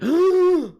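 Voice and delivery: high, loud, dramatic gasp; shocked gasp. Pretend. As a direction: gasp in horror. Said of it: "the jury's like".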